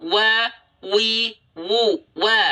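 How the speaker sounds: wa with lips pushed forward; rounded waw. Shown as a demonstration. Teacher showing proper waw, lips rounded. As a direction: push the lips out for each wa.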